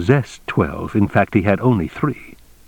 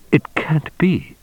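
slow, high, whispery protest; stunned disbelief; 7 semitones higher, slower than usual. A narrator's reading. Stunned dealer told gun is fake.

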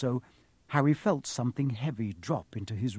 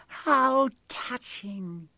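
high, very slow, mocking hiss; 10 semitones higher, much slower than usual. A narrator's reading. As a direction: stretch it out with villainous scorn. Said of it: "it hissed".

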